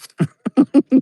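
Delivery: loud, high burst of laughter. Real, not acted. Laughing at asking the crew how they voted, high loud laugh. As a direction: crack up at your own joke.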